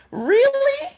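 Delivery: high disbelieving "Really?"; incredulous really. Real, not acted. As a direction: throw it up in disbelief. Incredulous retort.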